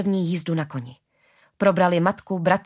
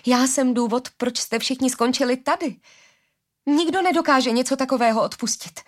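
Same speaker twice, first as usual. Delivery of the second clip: high, louder self-blame; anguished outburst; 8 semitones higher, 4 dB louder than usual. Acted. Daughter crying out her guilt to her father, high and loud. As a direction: cry out that it's all your fault.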